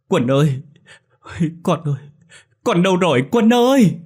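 gọi to, cao giọng, nghẹn ngào; gọi con như sắp khóc; gọi lớn mếu máo. A narrator's reading. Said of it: "vừa gọi lớn vừa mếu máo". (gọi lớn mếu máo giữa mưa)

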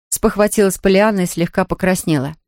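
usual speaking voice

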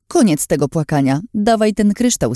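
high, imperious order; scornful demand. Acted. Lin demands the crystal from the crying girl, scornful. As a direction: order her about with contempt.

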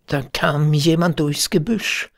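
ordinary delivery

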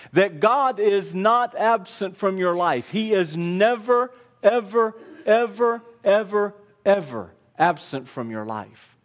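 emphatic repeated "ever", dropping at the close. Real, not acted. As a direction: hit each "ever" separately, high and hard. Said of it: "Very emphatically, I want you to hear this".

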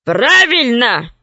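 slow growled gorilla voice; growling gorilla. Acted. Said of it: "зарычала горилла".

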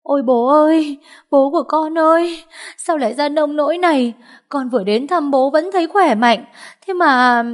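loud wailing. Acted. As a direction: wail over your father like a hired mourner. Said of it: "oang oang gào khóc".

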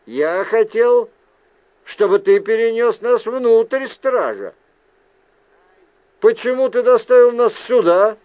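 высоко, громко, с требованием ответа; спроси с напором, повысив голос; недовольный упрёк. Acted. Недовольно требует ответа от призрака. (недовольно, с упрёком)

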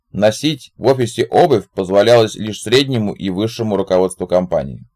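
plain reading voice

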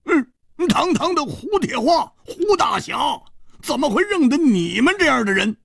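high-pitched haughty scorn; cold sneer. A narrator's reading. Hu Tiehua scorning the odd pair, sneering. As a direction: sneer with lofty indignation. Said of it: "胡铁花冷笑着说".

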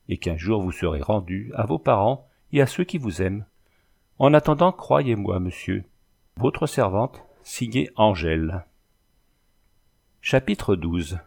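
usual speaking voice

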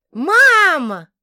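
high, loud cry of "Мама!"; joyful shout. Acted. Daughter shouting to her mother at the door, joyful shout. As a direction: yell it out in delight.

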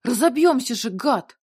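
loud, scared scolding; angry shout. A narrator's reading. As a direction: yell at the driver. Mila yelling at driver.

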